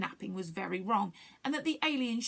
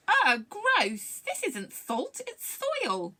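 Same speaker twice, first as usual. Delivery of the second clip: high-pitched, revolted outburst; disgusted squeal; 10 semitones higher, 5 dB louder, much slower than usual. A narrator's reading. Morwick discovers soil in the sandwich, squealing.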